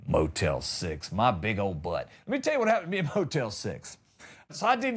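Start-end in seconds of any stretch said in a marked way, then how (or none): 0.1-2.1 low, slow dismissive drawl; scornful scoff. Real, not acted. Comic dismissing the overpriced motel, low scornful scoff. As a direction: scoff it far down in pitch.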